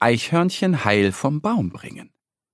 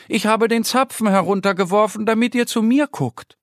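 higher, pleading squirrel voice; hurt squirrel explaining to duck and bear, higher voice; little squirrel; 7 semitones higher than usual. Acted. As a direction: ask for help, a little sorry.

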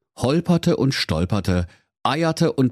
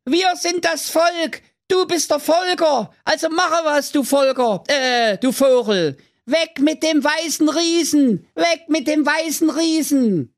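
high, shrill-free crowd shouting in a squeaky voice; indignant crowd chant; angry crowd. A narrator's reading. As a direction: chant it like an outraged mob of fairy folk. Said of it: "rief aufgebracht".

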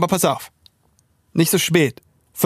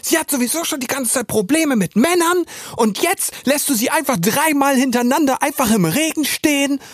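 much higher, put-on accusing girl's voice; angry best friend; 11 semitones higher than usual. Silvi, the best friend, accuses him on the phone: high, reproachful girl's voice. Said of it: "Und Silvi sagt".

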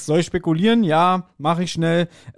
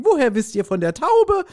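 surprised lady; 11 semitones higher than usual. Pretend. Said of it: "Dann wird sie sagen".

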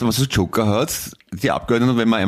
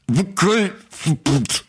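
much higher, very slow, strange put-on voice; mock vowel ban voice; 9 semitones higher, much slower than usual. Joking with his co-host about a vowel ban, much higher put-on voice. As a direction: stretch it out high and odd.

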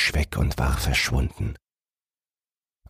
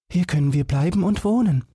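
put-on little girl's voice; 14 semitones higher, faster than usual. Girl thinks aloud in tale.

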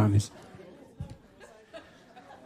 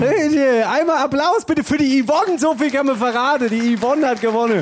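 announcer voice; 10 semitones higher than usual. Acted. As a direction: hype up the crowd. Hosting, announcing the winner.

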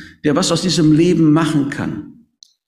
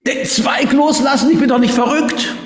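high, disbelieving cry; incredulous outburst; 10 semitones higher than usual. A narrator's reading. Man refuses in disbelief. (hoch und ungläubig aufschreien)

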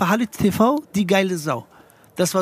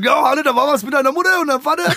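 higher, louder mock neighbour; neighbour teasing; 9 semitones higher, 4 dB louder, faster than usual. Neighbour repeating the gossip to the comedian, mock teasing. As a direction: tease like the nosy neighbour. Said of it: "Und dann sagt er".

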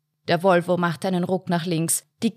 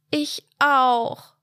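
put-on higher, louder whining girl; whiny child; 7 semitones higher, 4 dB louder than usual. A narrator's reading. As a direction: grumble like a sulky kid. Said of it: "maulte seine Schwester".

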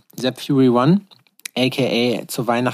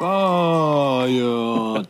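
ring announcer call. Shown as a demonstration. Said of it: "du musst so machen".

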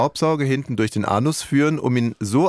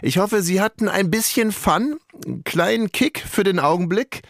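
upbeat announcer voice; radio host sign-off; 6 semitones higher than usual. Acted. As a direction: close the show for listeners.

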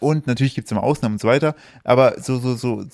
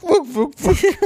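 high squeaky strangled noises; squeaky choking noises; 13 semitones higher, much slower than usual. Pretend. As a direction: squeak like a choked puppy. Imitating choking collar melody.

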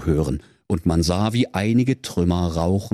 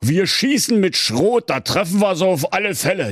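excited wolf; 9 semitones higher than usual. Acted. Wolf plans the shot.

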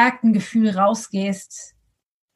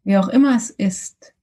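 guided meditation; 3 semitones lower than usual. Acted.

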